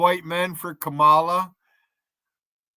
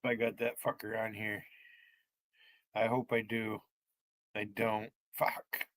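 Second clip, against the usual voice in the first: much softer, low, under the breath; quiet low grumbling while searching; muttering to himself; 7 semitones lower, 15 dB quieter than usual. Real, not acted. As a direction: grumble it to yourself. Muttering to self off mic.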